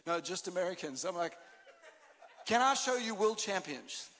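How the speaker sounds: ordinary talk, nothing marked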